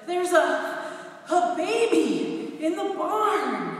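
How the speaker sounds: high, loud, gasping stammer of news; breathless announcement. Retold or imitated. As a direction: blurt it out panting, unable to finish. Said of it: "standing there panting in the kitchen".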